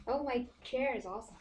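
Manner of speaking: usual speaking voice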